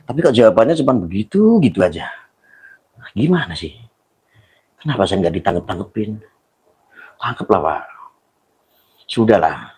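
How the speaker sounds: very low, quiet, dejected murmur; weary resignation. Real, not acted. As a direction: let it sink down as if giving up. Resigned after his protest goes unanswered. (pasrah dan lirih, nada sangat rendah)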